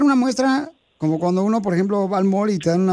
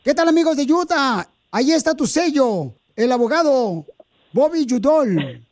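higher, upbeat radio spot; ad read; 3 semitones higher than usual. Acted. Host recording the lawyer's commercial, upbeat. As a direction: sell the lawyer to the audience.

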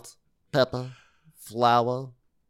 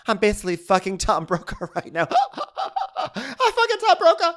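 much higher, loud laughing boast; giddy Rinna boast; 12 semitones higher, 5 dB louder than usual. Retold or imitated. Imitating Rinna bragging about her husband's role. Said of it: "she goes".

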